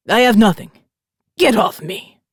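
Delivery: put-on loud, rough Italian accent. A narrator's reading. Trapped biker shoving back, loud Italian accent. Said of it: "rough Italian accent".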